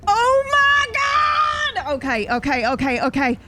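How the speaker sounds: drawn-out high shout, then quick okays; excited shout. Real, not acted. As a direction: explode with delight at the plan. GM reacts to player's plan.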